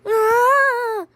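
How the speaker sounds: high-pitched acted scream. Captive Riley in the forest, screaming high. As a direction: cry out in a high, sustained scream. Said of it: "except for one screaming Riley".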